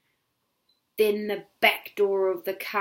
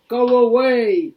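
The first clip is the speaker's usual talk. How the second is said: stern God; 4 semitones higher, 13 dB louder, slower than usual. Acted. God commands the witch.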